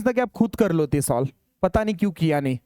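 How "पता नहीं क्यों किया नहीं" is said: ordinary delivery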